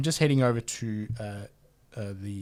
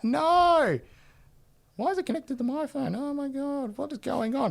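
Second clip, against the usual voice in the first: surprised alarm. Real, not acted. Tech fails during live show.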